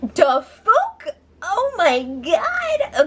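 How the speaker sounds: high, drawn-out disbelief; stunned, high oh my god; shocked. Real, not acted. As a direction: let the shock stretch out slowly. Shocked reaction to the shushing.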